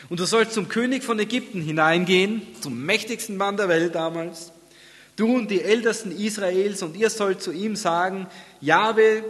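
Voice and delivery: scripture reading aloud. Real, not acted. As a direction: read it out high and strong, easing toward the close.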